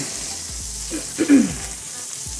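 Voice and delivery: rough throat clear. Real, not acted. Cough lingering for two months.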